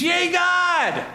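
high, shouted crowd cheer; celebrating cheer. Acted. Packed synagogue crowd celebrates God, jubilant shout. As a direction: whoop it out like a jubilant congregation. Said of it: "probably the celebrations".

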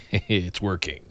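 villain's quiet gloat; sly whisper-quiet aside. Retold or imitated. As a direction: murmur it like a schemer gloating. Imitating Seth's cheeky grin.

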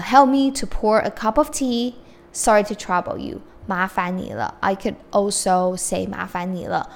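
usual speaking voice

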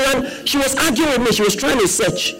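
high, loud, incredulous sermon delivery; forceful preaching. Real, not acted. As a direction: hammer it out to the congregation.

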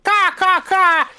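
shrill, loud, high crow caws; cawing bird laugh. A narrator's reading. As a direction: caw like a mocking crow. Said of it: "Laughing in that way that birds laugh".

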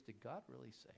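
soft, insinuating serpent question; villainous whisper. Acted. Serpent whispering doubt into Eve's ear, soft. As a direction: plant the doubt quietly. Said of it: "he whispers, this villainous whisper".

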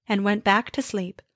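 usual speaking voice

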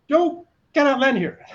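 high, loud and strained, refusing flatly; mock controller voice. Tower controller refusing the pilot, high loud mock voice.

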